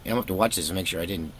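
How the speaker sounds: usual speaking voice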